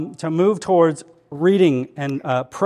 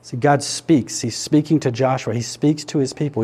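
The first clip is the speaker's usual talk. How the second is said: normal talking voice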